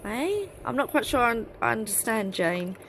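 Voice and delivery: ordinary talk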